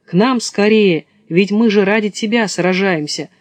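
plain reading voice

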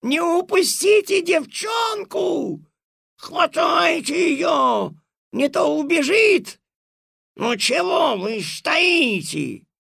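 high shrieking commands, slow and loud; furious yell. Acted. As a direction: scream orders in a rage. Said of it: "в ярости завопила".

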